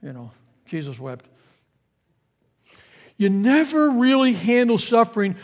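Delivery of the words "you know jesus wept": soft, low, tossed-off recital; kids reciting a verse. Retold or imitated. Kids giving their favorite verse, quiet throwaway recital. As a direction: mumble it low, like a kid's easy answer. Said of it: "that's where our kids get all this".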